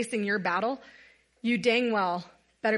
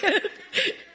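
a high, breathy burst of laughter; high giggle; 10 semitones higher than usual. Real, not acted. She giggles at herself in front of the group. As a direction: let a giggle escape. Laughing at her own moment.

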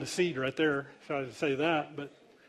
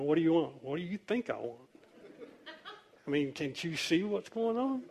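sarcastic; 7 dB quieter than usual. Acted. Pastor's imagined comeback to a praying girl, mildly sarcastic. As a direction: throw the question back with understated sarcasm. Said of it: "some of it's sarcastic".

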